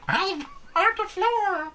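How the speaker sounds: high slow deflating squeak; deflating squeaky voice. Pretend. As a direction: squeak slowly as you deflate. Deflating clone's voice.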